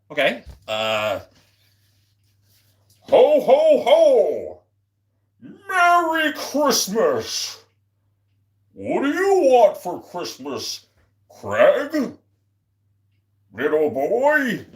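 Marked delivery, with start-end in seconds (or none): 3.0-14.7 hearty ho ho ho, then a slow Merry Christmas; Santa voice. Acted. Streamer asked by chat to play Santa, jolly Santa voice. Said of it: "Play some Santa".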